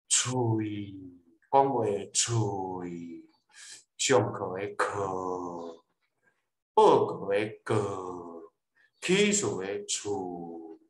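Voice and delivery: 喙, 课, 告, 厝 low, slow and sinking; weak, powerless third tone. Shown as a demonstration. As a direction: let 喙, 课, 告, 厝 sag down limply and trail long. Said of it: "就是无力、 轻声、 无力". (「喙、课、告、厝」第三聲，無力、低低落去)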